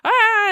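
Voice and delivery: high, loud mock scream; imitated scream. Player imitating the goblin facing the villain, screaming. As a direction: let out a high imitated shriek.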